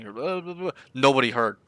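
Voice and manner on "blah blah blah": high, softer garbled babble; mock mumbling. Coach mimicking what the student sounds like, mock mumbling. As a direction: imitate unintelligible talk, high and soft. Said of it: "won't even understand what you're saying".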